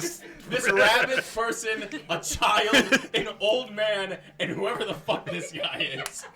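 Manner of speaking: laughing mock list. Mocking list of the squad.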